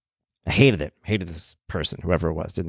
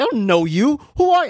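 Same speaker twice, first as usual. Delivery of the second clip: put-on high, indignant street-accent retort; tough accent coming out. Mover confronting a stranger, indignant tough accent. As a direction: throw it at him in a tough accent. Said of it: "I'm got an accent now".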